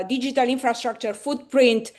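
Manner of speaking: usual speaking voice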